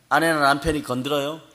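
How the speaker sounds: ordinary delivery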